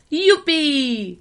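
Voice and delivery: high, loud, joyful whoop. A narrator's reading. Tramp jumping the water trough, whooping happily. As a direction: cry out with delight. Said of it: "vykřikl".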